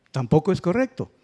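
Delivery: ordinary talk, nothing marked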